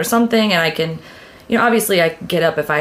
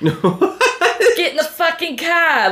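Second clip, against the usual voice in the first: put-on Boston accent; 8 semitones higher, much slower than usual. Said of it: "I was born with a Boston accent".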